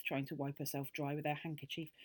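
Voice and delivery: ordinary delivery, nothing marked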